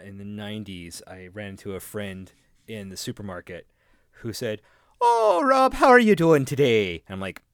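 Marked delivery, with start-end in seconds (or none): 5.0-7.0 high, loud put-on Scottish accent, hammed up; mock a friend's bad Scottish accent; fake Scottish accent. Friend greeting Rob in the supermarket, hammed-up fake Scottish accent. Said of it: "what's going on with the accent".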